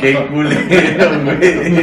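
slow, laughing and loud. Real, not acted. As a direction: draw it out through laughter. Laughing at friend's crude joke.

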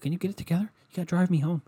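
high kidlike mock voice; imitating his son. Newborn son scolding crying dad, high mock kid voice. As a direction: talk down to dad like a bratty kid. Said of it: "He'd be like".

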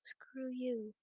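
soft, barely voiced aside; muttered under breath. Narrator cursing the chaperone under her breath, very soft. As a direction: mutter it to yourself.

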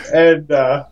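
usual speaking voice

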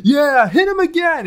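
high, loud, slow cheering commentator; Zbyszko impression. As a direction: egg him on like a gleeful commentator. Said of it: "Zbyszko on the commentary booth goes".

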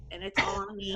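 mock self-pity. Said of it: "I'm like".

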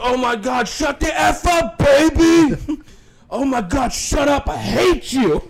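mock brother yelling at the baby, high and loud on shut up baby; hammed-up fed-up sibling shout; annoyed older brother. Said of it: "he goes".

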